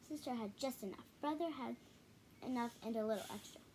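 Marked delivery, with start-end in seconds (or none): none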